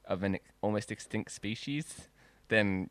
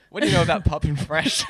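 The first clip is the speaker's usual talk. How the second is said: asked through laughter, louder; laughing; 8 semitones higher, 14 dB louder than usual. Pretend. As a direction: giggle through the question. Pitching the podcast, laughing.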